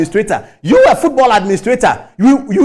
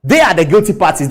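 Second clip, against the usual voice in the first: ordinary talk, nothing marked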